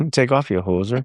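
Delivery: hamming up the Canadian joke line; lightly voiced Canadian; Canadian accent. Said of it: "Canadian accent".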